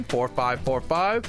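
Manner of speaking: ordinary talk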